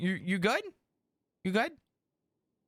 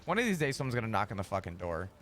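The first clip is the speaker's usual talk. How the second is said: usual speaking voice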